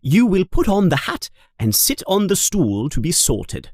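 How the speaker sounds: a strict teacher addressing new pupils; a hint of a stern professor; Professor McGonagall. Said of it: "she says".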